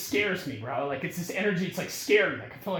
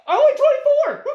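much higher and louder, amazed; incredulous outburst; 12 dB louder than usual. Real, not acted. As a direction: blurt it out in disbelief. Incredulous about stranger's praise.

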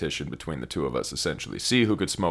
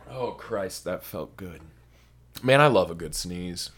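ordinary talk

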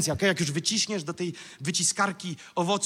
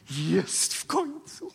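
mock relief; 5 semitones higher, much slower than usual. Voicing the waiting apaczy's relief.

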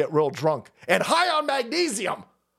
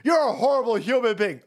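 imitating jeering protesters; 5 semitones higher than usual. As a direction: stretch out the shame like a heckler, pitched up. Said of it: "they're calling him".

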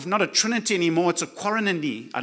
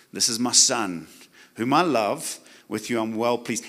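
voice of the Father; 9 semitones lower than usual. Acted. Father's voice spoken to listener.

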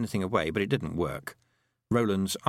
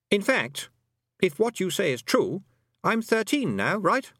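put-on high boy's voice, working it out; boy character, reasoning; 10 semitones higher, 3 dB louder than usual. A narrator's reading. Roland realising he's thirteen now, insistent high boy. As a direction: reason it out in a boyish high pitch.

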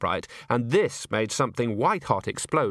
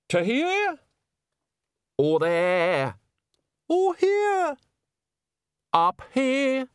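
thrown voice; 3 dB louder, much slower than usual. Acted. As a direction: voice thrown far off, jumping high. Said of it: "pitch it far away".

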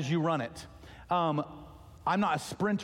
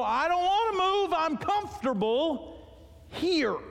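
high, whiny, dragged-out complaint; mock whine; 13 semitones higher, slower than usual. Pastor voicing a congregant refusing to move, mock whining. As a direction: whine like a reluctant kid. Pretend whiny excuse to God.

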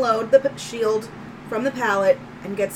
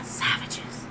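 whispering; 8 dB quieter than usual. Real, not acted. Unvoiced whisper.

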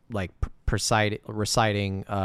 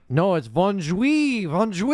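high, slow, condescending correction; hammed-up teacher correcting a student, slow and higher; mock teacher correction; 9 semitones higher, 5 dB louder, slower than usual. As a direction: drag out bonjour like a smug teacher.

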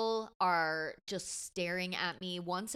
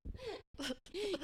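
stifled giggles; 13 semitones higher, 6 dB quieter than usual. Real, not acted. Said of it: "I'm trying not to laugh".

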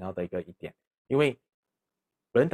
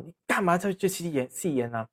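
higher, sharp retold telling-off; a father catching his son smoking; scolding father; 7 semitones higher than usual. Dad scolding his son for smoking, mock angry. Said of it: "爸爸就骂他说".

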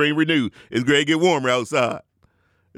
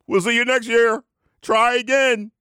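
mock send-off; 9 semitones higher than usual. Mock send-off to losing teams.

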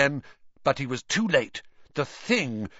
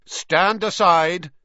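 commanding; 7 semitones higher, 7 dB louder, slower than usual. A narrator's reading. Riddle threatens with wand.

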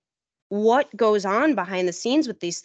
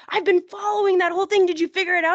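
eager fan; 10 semitones higher, 4 dB louder, faster than usual. Retold or imitated. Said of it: "One of 'em was like".

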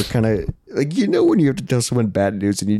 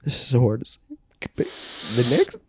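soft, halting fake-grave announcement; mock solemn; 2 semitones lower, 6 dB quieter than usual. Hosts teasing listeners with bad news, softly and halting. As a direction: act like breaking tragic news.

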